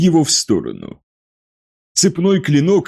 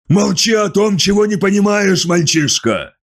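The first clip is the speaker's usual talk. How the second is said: growled in anger. A narrator's reading. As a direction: growl it, furious. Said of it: "прорычал Лемон".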